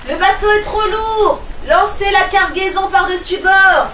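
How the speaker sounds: high, loud orders; captain ordering the cargo overboard; captain's shout. Acted. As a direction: shout over the sinking ship. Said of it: "crie le capitaine".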